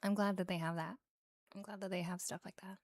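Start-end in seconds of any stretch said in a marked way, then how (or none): 1.5-2.8 hushed, whispery ASMR echo; much softer, breath-light delivery; soft repeat. Real, not acted. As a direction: let it sink into a whisper.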